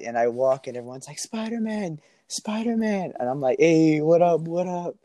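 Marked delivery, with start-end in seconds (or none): 1.2-3.1 high-pitched imitation of kids calling; high, drawn-out mock kid call; excited kids calling out. Kids spot and call him. 3.6-5.0 hailing a crowd. Retold or imitated. Greeting the crowd of kids.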